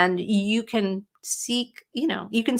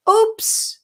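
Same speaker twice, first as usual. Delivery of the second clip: oops; 14 semitones higher, 4 dB louder than usual. Real, not acted. Catching a funny typo.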